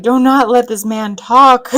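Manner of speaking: loud, slow, emphatic mock plea; mock outcry. Vlogger reacting to the man's accent, mock outcry.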